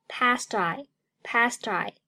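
"past I" joined, t carried onto "I"; linked pronunciation. Shown as a demonstration. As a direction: slowly link "past I" as one word. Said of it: "Past和I连读".

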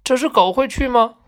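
high, eager question about the dog; bursting with excitement, ask about the dog; excited little boy. A narrator's reading. Little boy asks narrator about the dog, thrilled. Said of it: "他兴奋得不得了".

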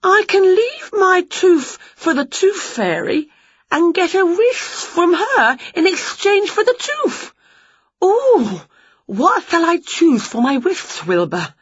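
light up with the idea; delighted realisation. Acted. Witch guessing her cat is a fairy, high and delighted. Witch excited about wish.

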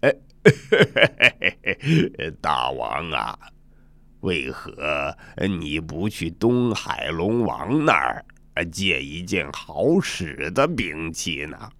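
put-on chuckle, then advise like an old monkey; chuckling old monkey. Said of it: "一个白眉白须的老猴笑呵呵地说道".

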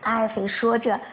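plain reading voice

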